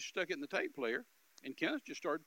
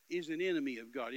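ordinary talk, nothing marked

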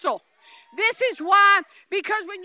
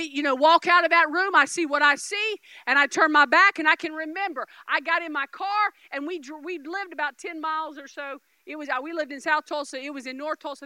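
ordinary talk

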